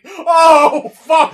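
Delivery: high, very loud laughing wail; retold shocked outburst. Viewer retelling an unexpected blow, retold outburst. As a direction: re-enact being blindsided, at full volume. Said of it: "I was just like".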